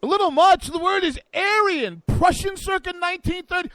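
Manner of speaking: high, loud, over-the-top retort; mock outburst. Loud mock retort to co-host's objection.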